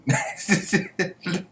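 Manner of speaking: amused chuckle at himself; laugh. Real, not acted. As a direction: laugh at your own silly accent. Amused at own accent.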